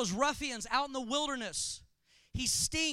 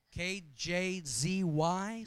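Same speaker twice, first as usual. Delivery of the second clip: smooth jazz DJ; 6 semitones lower, much slower than usual. Pretend.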